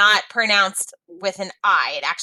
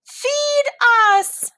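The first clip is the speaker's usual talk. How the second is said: high mock scream, not louder; screaming geese; 4 dB louder than usual. A narrator's reading. Geese demanding food from the queen, high mock scream. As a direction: play the hungry geese squawking out. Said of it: "they screamed".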